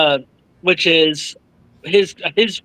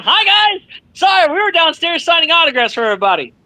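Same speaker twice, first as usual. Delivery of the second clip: high, fast, breezy put-on apology; mock breezy excuse; 11 semitones higher, faster than usual. Mocking late celebs' excuse.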